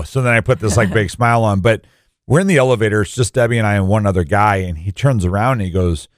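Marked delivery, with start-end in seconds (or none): none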